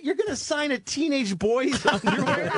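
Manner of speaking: high, drawn-out mock disbelief, laughter creeping in; retold incredulous scolding. Friend stopping a comic signing underwear, high incredulous scolding.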